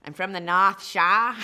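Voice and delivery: laughing Boston accent attempt; loud joking Boston accent. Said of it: "My best Boston accent".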